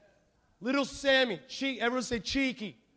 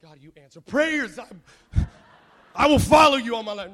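suddenly booming vow to God; exultant mock prayer; 15 dB louder than usual. Boy thanking God for his absent sisters, mock-exultant shout. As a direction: cry it out in hammed-up devotion. Acted exaggerated prayer of gratitude.

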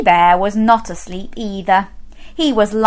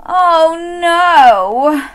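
higher, louder exasperated bear voice; fed-up bear; 7 semitones higher, 5 dB louder than usual. Acted. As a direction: groan it like a sleepless bear. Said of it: "said Mister Bear".

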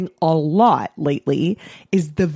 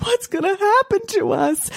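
put-on much higher, frightened kid voice; scared little boy; 13 semitones higher than usual. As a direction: whimper it like a worried child. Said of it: "my son Buddy would be like".

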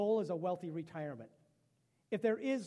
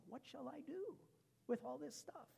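hushed fretting; 7 semitones higher, 10 dB quieter than usual. Acted. Rich man's worried question, hushed.

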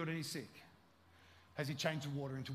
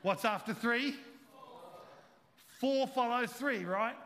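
louder repeat; 6 semitones higher, 5 dB louder, slower than usual. Shown as a demonstration. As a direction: ask again, louder and pushier, "three" rising. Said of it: "Let's do it one more time".

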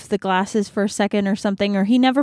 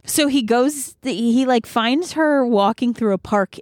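ordinary delivery

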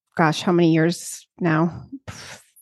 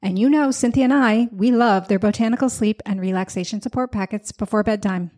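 upbeat sponsor read, smooth and polished; 2 semitones higher than usual. Acted. Host reading a sponsor ad to listeners, upbeat. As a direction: sell the product warmly to listeners.

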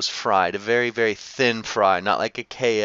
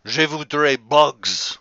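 slow, louder mock French; hint of a French accent; 3 semitones higher, 5 dB louder, slower than usual. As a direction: play the French chef asking for bugs. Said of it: "And he's like".

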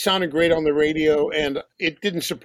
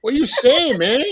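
higher Chicano street accent; vato ese voice; 9 semitones higher, 3 dB louder than usual. Shown as a demonstration. Showing the host how a big vato ese talks. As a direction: greet like a barrio vato. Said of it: "like a big vato ese".

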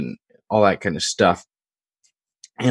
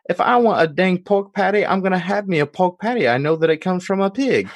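put-on higher-pitched Deep South country accent; [name]'s Deep South accent; 11 semitones higher than usual. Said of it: "that's the accent".

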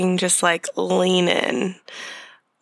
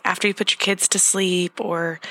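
ordinary delivery

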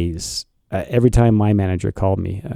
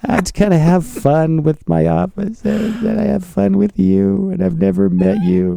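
nervous backpedal; 8 semitones higher than usual. Retold or imitated. Nervous call back to manager.

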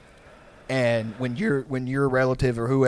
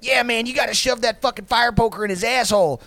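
Steve-O hyped; 11 semitones higher, 5 dB louder than usual. Retold or imitated. Steve-O quoted.

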